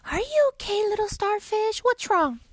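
high, loud kind little fish; Boyfish voice. Acted. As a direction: call out gently to a friend. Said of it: "I'll do the Boyfish".

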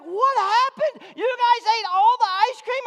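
imitating an incredulous high yell; hammed-up, loud, high-pitched astonishment; astonished mother. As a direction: shout it high in mock disbelief. Voices the mother's astonished reaction.

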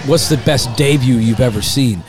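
usual speaking voice

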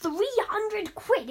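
high, slow, stunned repeat; shocked. Real, not acted. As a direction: gasp out the price in disbelief. Shocked at price.

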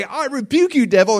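high, loud mock rebuke of the devil; a feeble rebuke said high and loud. Preacher mocking a weak rebuke to the devil.

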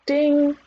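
high, ringing "ding"; imitated bell ding. A narrator's reading. Narrator voicing the round bell, high ding. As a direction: sound the bell with the voice.

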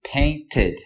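"painted." with two syllables, ending in a spoken "id"; correct pronunciation. Shown as a demonstration. Teacher modeling the past tense "painted." for students. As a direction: say "painted." with a full extra "id" syllable. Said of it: "en pasado sonaría".

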